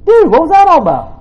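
high, loud "what was that"; loud baffled quote. Retold or imitated. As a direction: call out in disbelief. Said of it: "I'm like".

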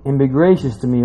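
normal talking voice

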